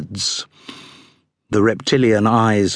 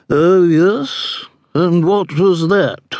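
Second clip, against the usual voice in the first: unimpressed; 8 semitones higher, much slower than usual. A narrator's reading. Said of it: "in a manner that showed she had few expectations".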